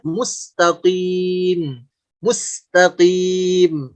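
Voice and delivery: slow "mustaqim" with back q and long i; moderately thick qi. Shown as a demonstration. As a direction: draw out "mustaqim", q not rounded. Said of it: "Seperti kalimat". ("mustaqim" pelan, qi tebal sedang)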